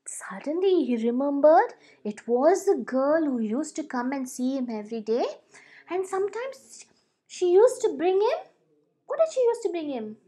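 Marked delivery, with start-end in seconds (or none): none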